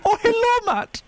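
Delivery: very high hammy Scottish falsetto; high-pitched put-on Scottish lilt; Scottish accent. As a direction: greet him as a Scottish nanny. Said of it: "My Scottish accent is".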